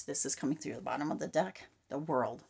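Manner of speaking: ordinary talk, nothing marked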